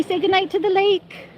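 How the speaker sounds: high lilting pet talk; cheerful sing-song farewell; sing-song goodnight. Real, not acted.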